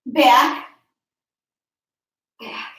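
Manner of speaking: ordinary talk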